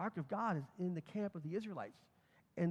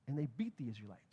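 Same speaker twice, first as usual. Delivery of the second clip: ordinary talk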